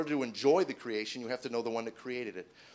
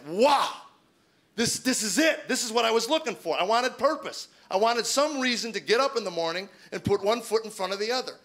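high, loud burst of revelation; amazed excitement; 9 semitones higher, 7 dB louder than usual. Retold or imitated. Man finding purpose for the first time, amazed excitement. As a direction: exclaim it like the light just switched on. Acted excited moment of discovery.

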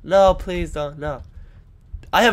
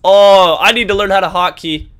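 ordinary delivery

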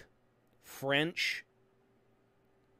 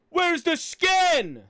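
slow, high yell of frustration; frustrated slow shout; angry yell; 11 semitones higher, 7 dB louder, much slower than usual. Real, not acted. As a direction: bellow it out, dragging the words. Yelled repeated complaint.

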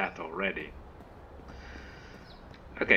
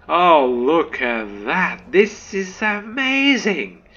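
loud, drawn-out wonder rising high; awed excitement; 6 semitones higher, 6 dB louder, slower than usual. Real, not acted. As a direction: marvel at the view, stretching it out. Awed reaction to game view.

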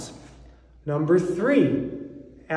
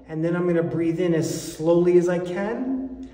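usual speaking voice

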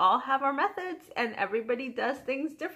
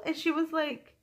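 ordinary talk

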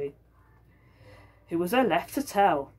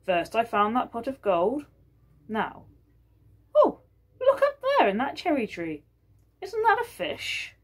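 higher, lightly voiced old husband recounting; Georgie's voice; 6 semitones higher than usual. Georgie recounting his finds.

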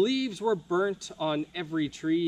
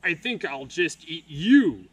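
louder troll growl, menacing; 7 dB louder than usual. Acted. Troll threatening to eat Leo, growling. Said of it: "he growled".

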